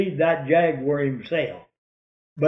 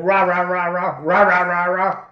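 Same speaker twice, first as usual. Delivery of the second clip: rapid rolling mock barks, slightly raised; storyteller's impression of a dog's bark; imitated dog baying; 2 semitones higher than usual. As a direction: imitate a hound baying in quick bursts. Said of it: "went to saying ... bayed".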